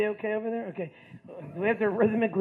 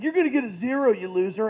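taunting; 2 semitones higher, 6 dB louder than usual. Retold or imitated. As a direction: tease a classmate like a schoolboy. Classmates taunt him in retelling.

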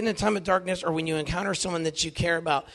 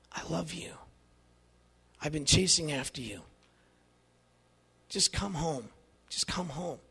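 much softer, low and gentle; tender; 3 semitones lower, 7 dB quieter than usual. Retold or imitated. Jesus opens his arms to the speaker, soft and tender. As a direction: invite him home softly.

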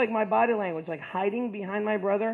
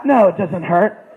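much louder, laughing brag; cocky laughing denial; 11 dB louder, slower than usual. Retold or imitated. Teen boasting to friends, loud and laughing. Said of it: "And I'm like".

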